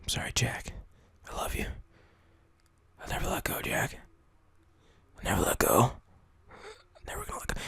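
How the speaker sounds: hushed, very soft farewell; playacted tearjerker whisper; mock grieving whisper. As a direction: whisper a melodramatic goodbye. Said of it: "I started talking like".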